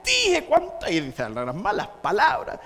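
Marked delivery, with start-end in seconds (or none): 0.0-0.9 high, loud, drawn-out furious yell; hammed-up shrieking tirade; angry screaming woman. Church lady storming out at whoever stoned her roof, screaming. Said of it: "la señora sale gritando".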